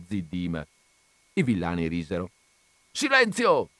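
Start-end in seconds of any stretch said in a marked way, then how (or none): 2.9-3.7 sharp, much higher, louder shout; the boss yells Silenzio at the laughing farmhands; shouted silence. Acted. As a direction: cut the laughter dead with one shout. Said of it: "gridò lo Zirafa".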